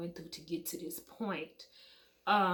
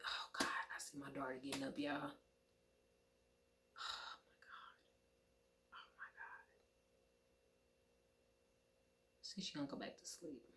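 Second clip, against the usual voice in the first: real whisper; 6 semitones lower, 17 dB quieter than usual. Real, not acted. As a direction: whisper so you don't wake your daughter. Daughter waking up nearby.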